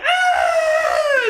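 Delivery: very high, loud terrified shriek; mock scream. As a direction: shriek back at the top of your voice. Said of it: "He's just like".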